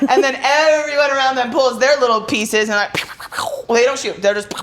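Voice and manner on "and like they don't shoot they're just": ordinary talk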